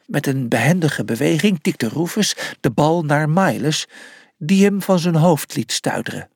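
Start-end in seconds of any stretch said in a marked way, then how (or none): none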